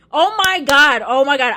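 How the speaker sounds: loud, high burst of delight; blurting it out, thrilled; excited outburst. Real, not acted. Loud excited gasp at her memory of the night.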